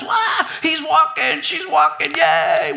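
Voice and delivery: high, drawn-out mock cheer; parents going nuts. Parents cheering a toddler's first steps, wildly excited. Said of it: "we go nuts".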